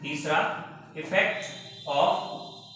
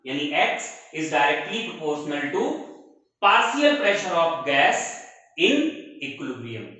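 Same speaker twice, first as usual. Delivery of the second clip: very slow repeat, word by word; slow repeated dictation; much slower than usual. Shown as a demonstration. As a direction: say the law again slowly for notes. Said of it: "फिर से बोलते हैं".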